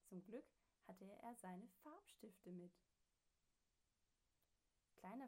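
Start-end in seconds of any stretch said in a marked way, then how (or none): none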